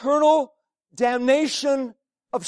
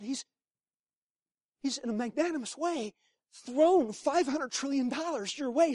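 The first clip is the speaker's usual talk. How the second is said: ordinary talk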